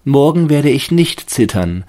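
high, loud hopeful tree; the fir tree resolving. A narrator's reading. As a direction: promise yourself bravely like the tree. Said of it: "dachte er".

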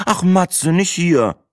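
high, exasperated groan; pleading, high-pitched annoyance. A narrator's reading. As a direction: moan a reproach at a partner. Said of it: "stöhnte Breitling und Sina".

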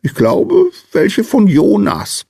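very high, hesitant squeak; tiny high-pitched pondering voice; squeaky little sandman. A narrator's reading. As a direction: squeak it slowly, as if thinking it over. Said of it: "piepste der kleine Mann".

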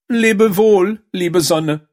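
high, lamenting farewell; narrated sob. A narrator's reading. Unhappy Däumelinchen bids the sun goodbye, acting a high sob. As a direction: cry it out high, loud at first, as if sobbing. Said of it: "schluchzte sie".

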